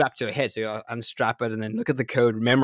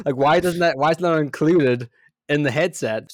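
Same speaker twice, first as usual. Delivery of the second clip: exasperated; 4 semitones higher, 6 dB louder than usual. Real, not acted. Exasperated rant about missing cable.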